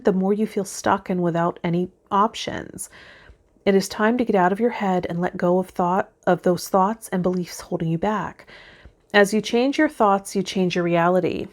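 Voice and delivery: usual speaking voice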